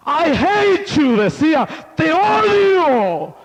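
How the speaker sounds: high, loud, stretched cry of rage; anguished shout at God. Retold or imitated. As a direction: yell it to the sky in grief and fury. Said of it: "empezó a gritar".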